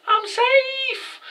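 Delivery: sing-song taunt, pitched far up; mocking squeaky high voice. Playmaker taunting, acted quote.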